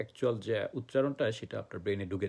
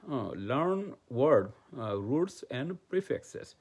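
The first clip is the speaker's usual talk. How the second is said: very slow, word by word, like dictating to a class; slow dictation; much slower than usual. Shown as a demonstration. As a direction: spell each word out slowly for learners. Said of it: "teacher dictating key phrase". (ধীরে ধীরে শিক্ষক শ্রুতিলিখন করাচ্ছেন)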